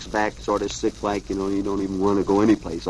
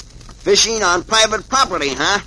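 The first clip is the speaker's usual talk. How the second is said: higher-pitched, lightly voiced policeman; 8 semitones higher than usual. A narrator's reading. Policeman challenges an unlicensed fisherman, lightly acted officer. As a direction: catch them out like an officer, pitched up. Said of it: "there comes the chief of police".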